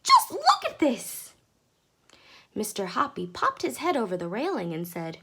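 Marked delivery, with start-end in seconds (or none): 0.0-1.3 very high, loud and slow; shrill thrilled cry; shrill excitement. A narrator's reading. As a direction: squeal it with delight. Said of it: "shrill with excitement".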